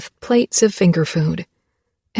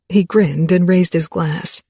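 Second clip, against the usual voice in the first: usual speaking voice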